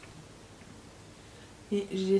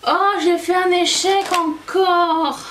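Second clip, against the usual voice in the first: high, loud, slow dismay; 9 semitones higher, 9 dB louder, slower than usual. Real, not acted. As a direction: wail it in mock despair. Makeup mistake exclamation.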